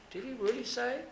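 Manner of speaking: high, sly questioning voice; devil's insinuating whisper of doubt; sly serpent. Retold or imitated. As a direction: slip the doubt in like a tempting serpent. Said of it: "Remember the first thing that he said?".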